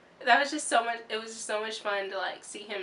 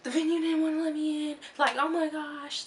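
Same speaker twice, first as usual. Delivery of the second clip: high, put-on rant voice; going off; 7 semitones higher than usual. Fan ranting to Bozzy about the venue, high-pitched. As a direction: vent it in a higher mock voice. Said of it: "I just go off".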